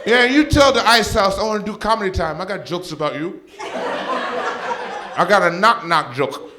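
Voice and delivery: high, loud mom voice mocking her son; drunk mom taunting. Mom taunting her comedian son, high and loud. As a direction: needle him like a tipsy mother. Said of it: "she goes".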